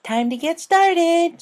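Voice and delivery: a creepily cheerful captor; cheery villain. A narrator's reading. Tormentor greets captive Rainbow, slow creepy cheer. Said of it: "a cheery voice".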